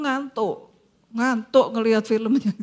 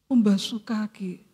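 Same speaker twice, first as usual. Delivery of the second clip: ordinary delivery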